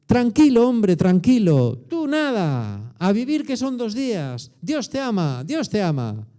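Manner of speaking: high, slow, syrupy reassurance; mock soothing. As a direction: coo it like a complacent pastor. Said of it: "Jesús nunca habla así". (tono meloso y condescendiente, agudo y lento)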